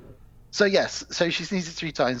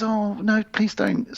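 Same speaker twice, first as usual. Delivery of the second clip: pleading father; 8 semitones higher, 3 dB louder, slower than usual. Acted. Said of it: "William says".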